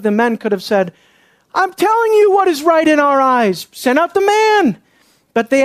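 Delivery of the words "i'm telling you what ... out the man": angry demand. Acted. Imagined mob demands the man.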